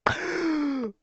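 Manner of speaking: high-pitched burst of laughter; loud laugh. Real, not acted. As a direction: cackle loudly at the clip. Reacts to clip.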